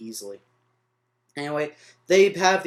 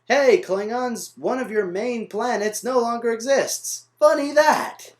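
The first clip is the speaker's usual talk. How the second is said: raised, loud sarcastic call; mock announcement; 8 semitones higher, 4 dB louder, slower than usual. Reviewer mocks their argument to the Klingons, sarcastic announcement. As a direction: play a mocking announcement, slow on the opening, higher to the end.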